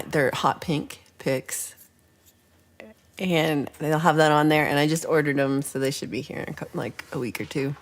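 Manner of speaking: ordinary talk, nothing marked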